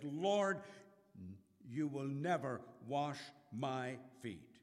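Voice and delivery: loud, upset protest. A narrator's reading. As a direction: cry out in exasperation, not calm. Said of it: "His voice is raised. He's not talking in a calm tone. He is exasperated".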